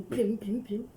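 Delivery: pim pim pim, popped out like little farts; mock fart sound effect. Wife mimicking her husband's noise, punchy pops.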